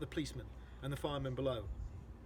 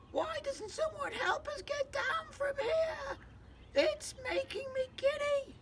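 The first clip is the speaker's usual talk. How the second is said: very high, slow, tearful wail; shrill panicked spider call; frightened crying out; slower than usual. Acted. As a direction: wail for help, dizzy and scared. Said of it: "Miss Spider called out".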